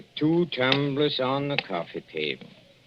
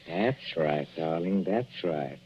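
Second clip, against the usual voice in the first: lower, softer, slow reassurance with menace; low soothing; 7 semitones lower, 6 dB quieter, slower than usual. Acted. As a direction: coo it down like a lullaby.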